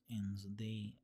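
slow mix of Middle Eastern and Russian, lightly done; a hint of swirled Eastern European accent; rat folk accent. Shown as a demonstration. Demoing her rat family voice for chat, a light mixed accent. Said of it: "where they talk like this".

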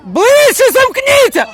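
high, drawn-out yell across the pitch; urgent shout. Real, not acted. Urging the players to close up, shouting. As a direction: holler it slowly, loud and high.

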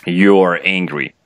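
ordinary delivery

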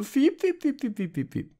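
high, soft squeaking bat. Acted. Fiep mache ich sonst. (leises Fledermaus-Fiepen)

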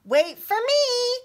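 very high, loud, slow call after friends. A narrator's reading. Little pony calling after racing friends, loud and high. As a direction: call after them so they wait. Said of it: "called Roni as the others all went zooming past".

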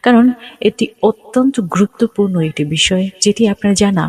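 ordinary talk

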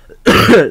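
one sharp loud choking cough. Real, not acted. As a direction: cough hard on a crumb. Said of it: "I'm choking here".

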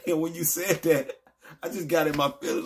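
ordinary talk

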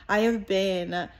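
ordinary talk

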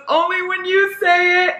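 high, slow, breathy-free swoon voice; swoony quote. Retold or imitated. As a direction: read it like a melting love interest. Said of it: "And he goes".

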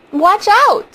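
loud, high-pitched scream of alarm; sudden panicked shout, voice leaping up; screamed warning. A narrator's reading. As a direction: scream it out to warn him. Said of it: "screamed Lydia".

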